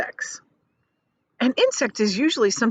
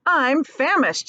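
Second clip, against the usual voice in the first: higher and slow; a hint of an old grasshopper; lightly voiced grasshopper; 7 semitones higher, 3 dB louder, slower than usual. Old grasshopper complaining of hunger, mildly higher and slow. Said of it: "said the old green grasshopper".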